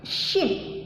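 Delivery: "Shin" hissed from the middle of the tongue; teacher hissing "Shin" for pupils; shin sound demo. Shown as a demonstration. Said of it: "keluar dari tengah-tengah lidah".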